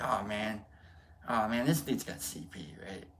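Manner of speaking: low, soft imitated aside; hushed reaction. Onlookers commenting among themselves, imitated hushed aside. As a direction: imitate a discreet onlooker's remark.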